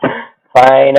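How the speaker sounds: ordinary talk, nothing marked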